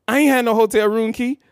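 retold protest to the girlfriend; indignant denial. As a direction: insist you're innocent. Denying the accusation.

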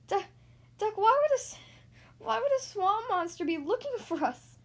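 high, stuttering, loud at first; panicked stammer. A narrator's reading. Goose asks Duck why it's hunting them, panicked stammer. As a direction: blurt the question in rising panic.

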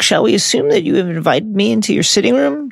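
lightly voiced headmaster, pitched high; a hint of courteous character voice, up high; Dumbledore. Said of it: "Dumbledore says".

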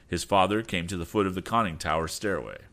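plain reading voice

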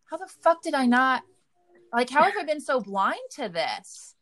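half-wailing at her own blindness, replaying it; stunned disbelief. Wife recalling finding track marks on husband, high, stunned disbelief. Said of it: "And I was just like".

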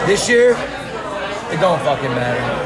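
normal talking voice

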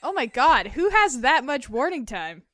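loud amazed outburst. Real, not acted. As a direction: react in loud disbelief. Amazed reaction to fart song.

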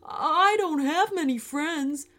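high, plaintive donkey voice; lonely donkey. Acted. Lonely donkey confiding in his friend, high and plaintive. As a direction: plead in a high little voice.

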